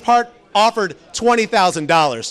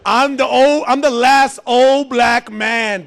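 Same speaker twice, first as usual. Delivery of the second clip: slow, raised proclamation; Morgan Freeman; 3 semitones higher, much slower than usual. Retold or imitated. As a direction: declare it from a mountaintop, drawn out. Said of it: "He was like".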